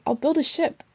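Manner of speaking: high, bright burst of a sudden idea; excited cry. Acted. Boy announcing his plan in an excited cry. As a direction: blurt out the plan with glee. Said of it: "He cried".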